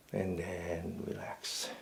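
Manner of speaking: slow, low and very soft; relaxing release. Real, not acted. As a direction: let it melt down slowly and quietly. Releasing the held stretch.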